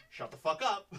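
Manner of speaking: loud snapped inner scream. Retold or imitated. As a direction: bark it like the voice in your head. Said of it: "it also screams".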